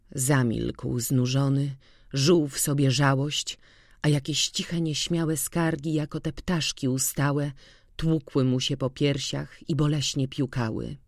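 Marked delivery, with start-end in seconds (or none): none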